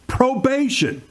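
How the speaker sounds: high-pitched exclamation of disbelief; incredulous outrage. Real, not acted. Commentator reacting to a one-year probation sentence, incredulous.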